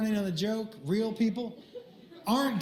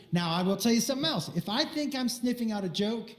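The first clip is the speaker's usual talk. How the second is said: normal talking voice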